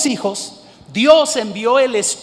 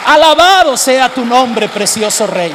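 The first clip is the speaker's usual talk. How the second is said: loud praise; 3 semitones higher, 7 dB louder than usual. Acted. Preacher praising God aloud.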